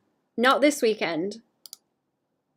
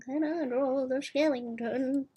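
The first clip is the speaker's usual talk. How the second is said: put-on high, slow old granny voice; 5 semitones higher, 4 dB quieter than usual. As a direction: creak it out like a little old granny. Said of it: "Clown voice and granny voice".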